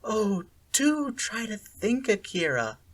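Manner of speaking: patronize her calmly; even and cool. A narrator's reading. Sally Ann lecturing Akira, even and cool. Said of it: "her voice even and cool".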